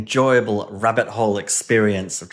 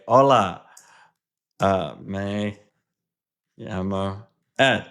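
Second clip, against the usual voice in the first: terrible Spanish; 4 semitones lower, slower than usual. Shown as a demonstration. Learner answering the Spanish tutor bot, hesitant beginner's Spanish accent. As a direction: try out your first Spanish words, unsure. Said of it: "even if you speak terrible Spanish like me".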